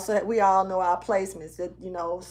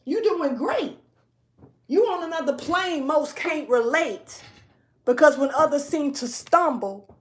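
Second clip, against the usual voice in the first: speaking strong; 3 semitones higher, 5 dB louder than usual. Real, not acted. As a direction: deliver it loud and high, stretching the key words. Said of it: "it's got me speaking strong right now".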